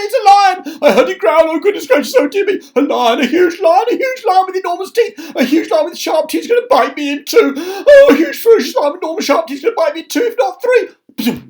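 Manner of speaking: very high, loud panicky wail; terrified babble. Acted. Terrified of an imagined lion.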